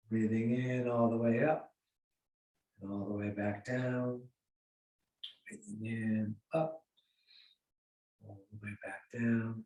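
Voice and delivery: soft, low, unhurried breathing cues; guided breathing. Real, not acted. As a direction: lead them calmly through each breath. Leads slow breathing exercise.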